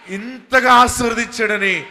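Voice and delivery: rising, shouted blessing; preaching shout. Real, not acted. Preacher rousing the congregation, shouting. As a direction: lift the line into a shout.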